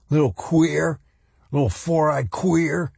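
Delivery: high, jeering schoolyard bully taunt; raging bully. A narrator's reading. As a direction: rage at him like a bully. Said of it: "Armbruster had raged".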